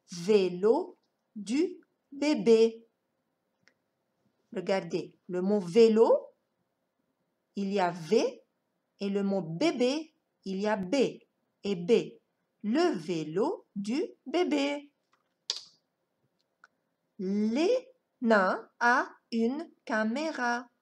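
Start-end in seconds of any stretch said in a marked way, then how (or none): 0.1-2.8 "vélo du bébé" slowly, word by word with pauses; slow sounded-out reading. Shown as a demonstration. Slow model reading of a sentence. 17.2-20.7 "Les nains a une caméra" slowly, piece by piece; slow sounded-out reading. Shown as a demonstration. Slow model reading of a sentence.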